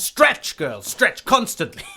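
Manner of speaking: high, loud, then slow command; a loud, high ballet master drilling a student; barked order. Acted. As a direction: order her to stretch. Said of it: "Hattori orders stretching".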